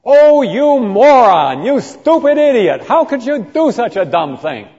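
contemptuous insult. Retold or imitated. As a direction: spit the insults at him contemptuously. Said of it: "the equivalent would be to say".

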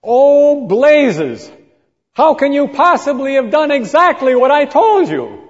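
loud, high cry of exasperation; furious exclamation of frustration; exasperated outburst. Retold or imitated. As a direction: blow up at him in disbelief. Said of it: "his first response was".

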